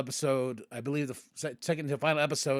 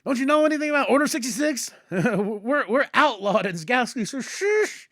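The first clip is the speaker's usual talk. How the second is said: frantic shushing; 9 semitones higher, 6 dB louder than usual. Retold or imitated. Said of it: "And Ahsoka's like".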